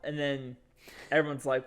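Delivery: normal talking voice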